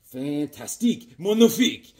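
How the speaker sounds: hammed-up French chef accent. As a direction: kiss the fingers, French chef style. Mock French praise of art.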